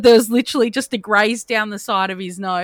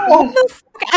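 high, loud disbelief; acted-out horrified outburst; shocked exclamation; 10 semitones higher, 6 dB louder than usual. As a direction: blurt it out, reliving the shock. Shock at missing finger, retold.

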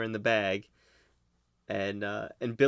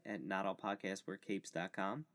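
quick steady promo read; 10 dB quieter than usual. Acted. Host reading the podcast plugs, steady ad voice. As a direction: read the plug like a radio outro.

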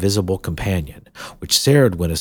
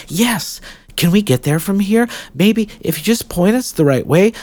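put-on higher, earnest girl voice; hopeful young girl; 9 semitones higher, 6 dB louder than usual. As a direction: ask hopefully like a child. Jill explains hopefully.

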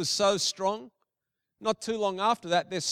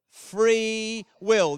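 "Free will" drawn out and firmly stressed; "Free will" as two weighty, separated words; emphatic repetition; 4 dB louder than usual. Real, not acted. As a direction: lean hard on "Free", then land "will". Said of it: "I'm emphasizing that word".

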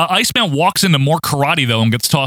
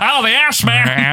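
high, drawn-out mock announcer call; Boyd Pierce; 7 semitones higher, slower than usual. Host filling in for absent announcer, mock drawn-out call. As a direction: stretch it out like a ringside caller. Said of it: "isn't here to call him the Ass Man".

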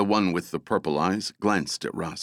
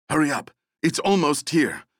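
higher, louder panicked call; urgent shout; 8 semitones higher, 3 dB louder than usual. Acted. Woman yelling at her team as the beast nears, panicked. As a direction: yell at them to hurry before it arrives.

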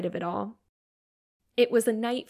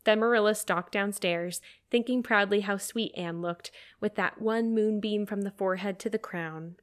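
ordinary delivery, nothing marked

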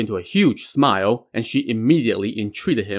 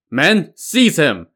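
loud, higher and slow command; shouted order; 6 semitones higher, 5 dB louder, slower than usual. A narrator's reading. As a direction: call the soldiers out of hiding. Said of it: "Yang Jian now shouted".

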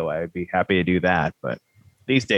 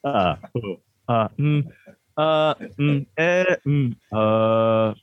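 filler sounds; 4 semitones higher, much slower than usual. Shown as a demonstration. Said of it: "All the ahs and all the ums".

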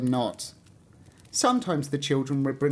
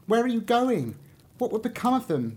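put-on curious young tree; 8 semitones higher than usual. A narrator's reading. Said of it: "wished very much to know".

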